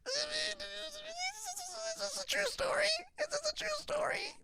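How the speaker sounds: very high, squeaky disbelieving voice; Bert Kreischer impression. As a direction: shriek it like Bert in shock. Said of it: "impression of both of 'em".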